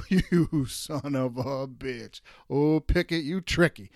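slow, chuckling admiration; laughing grudging curse. Real, not acted. Host laughing about Pickett's fake slide, slow and amused. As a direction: laugh it out, impressed despite yourself.

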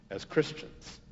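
ordinary delivery

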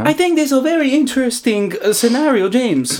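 a hammed-up Thai accent for the podcast. Podcast host starts his accent corner in a put-on Thai accent. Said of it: "this is the accent".

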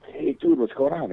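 high excited "hey dude"; cheery greeting. Retold or imitated. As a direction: greet an old friend you just spotted. Retold meeting with nurse.

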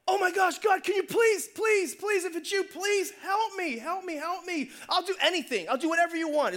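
high-pitched frantic begging; desperate pleading. Acted. Hanging man begs God to save him, high frantic pleading. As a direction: plead urgently, as if hanging on for life.

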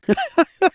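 a burst of high laughter. Real, not acted. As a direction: laugh out at the memory. Laughing at glued head story.

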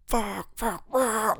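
high, strained wail of frustration, held back in volume; mock freak-out. Retelling his meltdown over a lost contact, mock freak-out. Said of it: "just that guy freaking out".

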